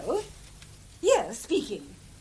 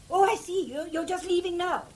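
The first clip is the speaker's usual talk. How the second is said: usual speaking voice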